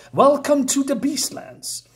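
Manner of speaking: high, slow creature voice greeting the knight; a high drawn-out welcome to a strange land. Acted. Creature welcomes the knight to the Beastlands, high and slow.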